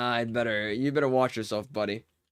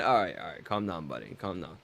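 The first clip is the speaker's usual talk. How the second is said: mock soothing; 9 semitones lower, 6 dB quieter than usual.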